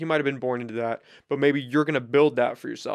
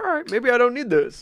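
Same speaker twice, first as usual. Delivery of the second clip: high, laughing self-quote; 10 semitones higher, 3 dB louder than usual. Retold or imitated. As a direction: laugh through the line. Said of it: "and it's like".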